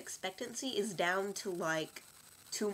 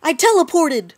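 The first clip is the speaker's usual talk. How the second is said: excited shout; 5 semitones higher, 17 dB louder than usual. Acted. Sudden shouted character line.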